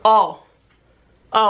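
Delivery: normal talking voice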